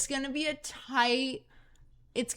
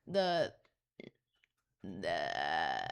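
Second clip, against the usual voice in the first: starts the voice far lower and drags the first word; Elon Musk impression; 10 dB quieter, much slower than usual. Joking with the cohost, a very low mock Elon voice. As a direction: sinks into a very low mumbling 'the' as if warming up. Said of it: "let me do my best Elon Musk impression".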